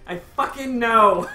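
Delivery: loud, slow frustrated insistence; exasperated. Real, not acted. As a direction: snap back in mock annoyance. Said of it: "I know that".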